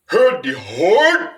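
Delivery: a huge howled "Heard the horn!", slow, pushed high at the end; Sasquatch call. Shown as a demonstration. Demonstrating the Sasquatch greeting to viewers, as a huge howled call. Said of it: "so it would be performed like this ... Bulgarian, Norwegian accent".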